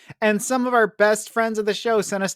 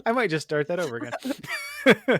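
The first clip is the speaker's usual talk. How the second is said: ordinary delivery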